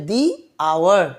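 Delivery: "দি", a pause, then "আওয়ার"; THE as "dee". Shown as a demonstration. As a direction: model "দি" with a long ee before "আওয়ার". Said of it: "দি উচ্চারণ করা হচ্ছে". ("the"-কে "দি" উচ্চারণ)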